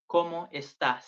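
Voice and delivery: ¿cómo estás? said loud, stress on cómo, falling; Spanish model phrase. Shown as a demonstration. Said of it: "That means".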